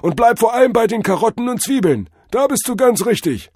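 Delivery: very high, loud guru instruction; jive-talking drug-vision god. A narrator's reading. God instructs Cordell on carrots and onions, high guru. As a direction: drive the lesson home as a trippy god. Figure of Tod Hermes.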